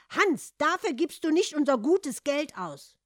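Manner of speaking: high, loud motherly protest; horrified cry. A narrator's reading. Mother forbids Hans to spend money, horrified cry. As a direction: cry out to stop him. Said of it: "rief die Mutter entsetzt".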